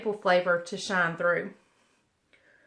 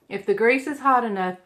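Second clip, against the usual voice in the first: ordinary talk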